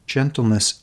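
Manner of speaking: normal talking voice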